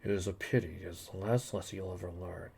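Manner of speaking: low, very soft threat; quiet menace. Acted. Villain to the man who crossed him, quiet menace. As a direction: threaten him almost under your breath.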